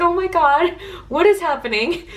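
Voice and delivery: high-pitched, half-laughing retold alarm; amused mock alarm. Friends in a stalling car in city traffic, retold in high amused alarm. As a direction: exclaim high, as if laughing at the panic.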